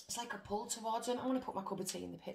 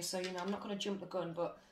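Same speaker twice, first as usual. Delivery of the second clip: ordinary talk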